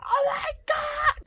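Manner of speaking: very high, drawn-out squealing cry; mock overjoyed scream. Mum hearing daughter's news on the phone, imitated shrieking. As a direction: shriek it slowly as an ecstatic mum. Mum's reaction to the good news.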